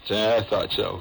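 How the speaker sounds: normal talking voice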